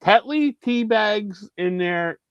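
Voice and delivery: high-pitched comic insistence; exasperated retort. Retold or imitated. Acted retort to brother's tea question.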